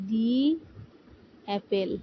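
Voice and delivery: দি said as "dee" before অ্যাপেল; the before a vowel. Shown as a demonstration. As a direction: pronounce দি with a long ee sound, then অ্যাপেল. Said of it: "এটাকে আমরা পড়বো দি". (স্বরবর্ণের আগে দি, অর্থাৎ "ডি" উচ্চারণ)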